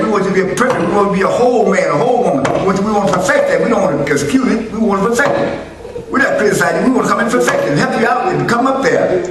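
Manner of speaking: high, loud preaching cadence; preaching at full force. Acted. Preacher urging the church to perfect faith, loud and high. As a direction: drive it home to the congregation.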